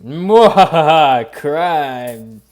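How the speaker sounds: slow, loud villain cackle into the word; sneering panto villain. Pretend. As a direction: ham it up like a cartoon baddie. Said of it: "I've become unhinged".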